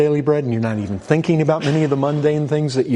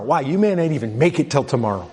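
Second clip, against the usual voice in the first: ordinary talk, nothing marked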